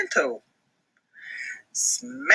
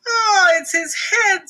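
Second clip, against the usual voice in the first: high, slow loud cry; high horrified wail; 13 semitones higher, 5 dB louder, slower than usual. Acted. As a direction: wail in horror. Bibi thinks Max drowned.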